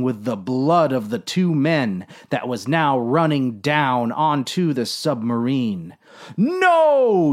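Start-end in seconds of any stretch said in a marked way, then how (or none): 6.4-7.3 long, high, loud cry; yelled protest. A narrator's reading. Captain seeing his men shot, anguished yell. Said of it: "yelled the captain".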